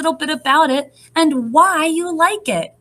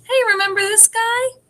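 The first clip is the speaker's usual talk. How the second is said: lightly voiced high storybook figure; a little higher, playful character line; 11 semitones higher than usual. Character line in picture book.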